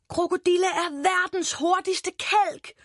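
high, thrilled proclamation; excited shout. A narrator's reading. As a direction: proclaim it with boyish amazement. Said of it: "råber Boris". (begejstret, højt udråb)

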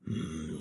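unvoiced scheming hum; breathy greedy wolf hum. A narrator's reading. Wolf thinks to himself, greedy hum. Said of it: "tænkte ulven".

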